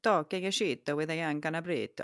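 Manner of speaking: ordinary talk, nothing marked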